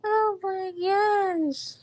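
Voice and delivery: slow, high stunned exclamation. Real, not acted. Reacting to the girl saying no, slow shocked disbelief. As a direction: draw it out in shock.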